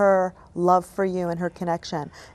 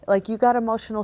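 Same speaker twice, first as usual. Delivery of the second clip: normal talking voice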